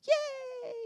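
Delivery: high, drawn-out cheer. Real, not acted. Coach welcomes the volunteer back, cheering. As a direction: welcome them back with a high, long yay.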